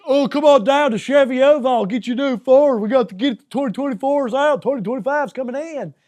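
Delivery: high, loud hammed-up Southern car-salesman voice; a Southern car dealer's pitch, loud and high. Hammed-up Southern dealer ad for listeners, loud and high. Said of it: "It's not Texas".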